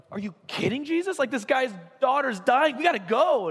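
high, loud urgent protest; acted-out frantic exasperation; frustrated disbelief. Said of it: "the frustration of the people around him".

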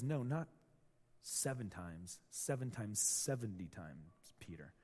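soft and slow, gently setting Peter straight; Jesus softly telling Peter the real number; gentle correction. Acted. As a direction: quietly correct a friend.

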